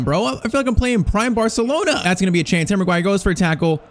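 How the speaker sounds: ordinary talk, nothing marked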